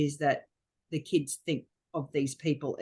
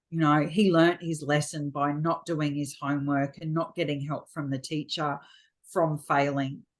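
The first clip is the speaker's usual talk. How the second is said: usual speaking voice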